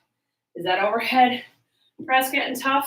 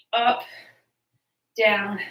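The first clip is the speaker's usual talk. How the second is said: ordinary talk, nothing marked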